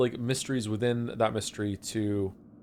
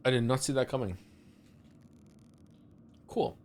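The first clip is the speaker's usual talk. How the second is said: ordinary talk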